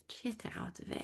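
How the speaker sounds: whispered swear, half-joking. Real, not acted. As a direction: drop the swear word to a whisper.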